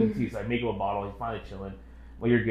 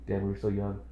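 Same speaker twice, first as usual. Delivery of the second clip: ordinary talk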